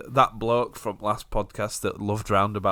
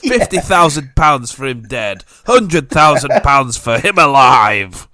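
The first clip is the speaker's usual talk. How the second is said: loud, higher villain bounty shout; roaring tyrant king; 4 semitones higher, 11 dB louder than usual. Pretend. Mock villain bounty call.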